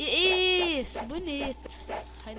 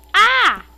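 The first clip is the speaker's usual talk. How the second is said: startled yell; 9 semitones higher, 19 dB louder than usual. Real, not acted. Sudden shock in game.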